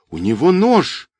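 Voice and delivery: put-on high-pitched girl's warning; desperate cry. A narrator's reading. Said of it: "отчаянно закричала девушка".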